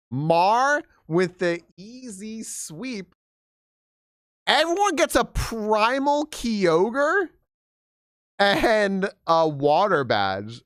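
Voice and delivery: slow, grand victory proclamation; mock announcer. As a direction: announce the win like a ceremony.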